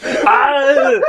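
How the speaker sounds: long, high "aah" scream; hammed-up war cry; mock battle yell. Acted battle yell at Predator.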